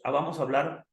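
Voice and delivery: usual speaking voice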